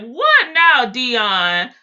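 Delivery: loud, high exasperated mom; loud mock-angry mom; mom snapping back. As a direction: shout it like a fed-up mother. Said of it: "She like".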